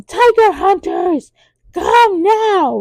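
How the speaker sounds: high, shouted call for backup; shouted summons. Retold or imitated. Inspector General calling his men again, high shout. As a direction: yell for the hunters to appear. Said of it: "he goes".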